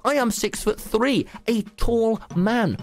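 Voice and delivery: self-redeeming insistence. A narrator's reading. Tommy telling the ladies he is tall, emphatic insistence. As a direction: insist on it, slowing at the end. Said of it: "trying to redeem himself".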